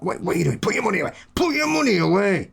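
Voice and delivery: mock annoyance, rising high; hammed-up offended friend refusing payment; imitating annoyed friend. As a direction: build to a high, exasperated protest. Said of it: "He gets really annoyed".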